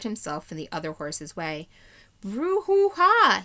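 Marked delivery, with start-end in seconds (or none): 2.2-3.4 loud high whinnying snort; horse snort. Acted. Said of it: "he snorted".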